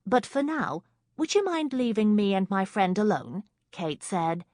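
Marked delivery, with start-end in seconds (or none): 0.1-3.4 pointed. A narrator's reading. Kate dismisses the intruder.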